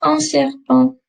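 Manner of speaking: "serpent" ending on a nasal "an", no T; modelling "Un serpent" slowly, nasal ending. Shown as a demonstration. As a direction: let "serpent" fade into the nose. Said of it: "Répétez, s'il vous plaît".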